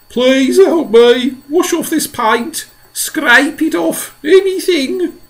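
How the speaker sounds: high, loud, slow desperate begging; begging centipede. A narrator's reading. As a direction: beg for rescue. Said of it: "bawled the centipede".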